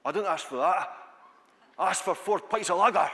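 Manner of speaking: loud, indignant attempt at Glaswegian; bad Glaswegian accent. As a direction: bellow it like an aggrieved Glasgow bar customer. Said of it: "my bad attempt at a, a, a Glaswegian accent".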